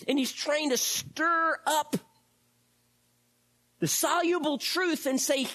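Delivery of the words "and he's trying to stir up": usual speaking voice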